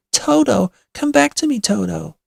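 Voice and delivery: high, loud call falling away. A narrator's reading. Dorothy worried about her little doggy, calling. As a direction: call a lost dog back.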